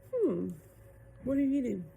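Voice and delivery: curious. A narrator's reading. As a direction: perk up at the food. Mermaid curious about lunch.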